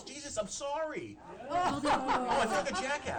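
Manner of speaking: laughing at his own blunder, high and loud; sheepish giggle through the apology; embarrassed laugh. Real, not acted. Chair realizes his mix-up.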